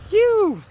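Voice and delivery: loud falling "whew" whoop; high whoop. Real, not acted. Truck made it over the snow, high whoop. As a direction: whoop high, then let it drop.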